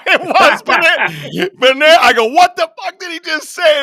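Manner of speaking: high, loud words through laughter; can barely get it out for laughing; laughing hard. Real, not acted. Brother recalls the joke, laughing high and loud. Laughing while retelling the story.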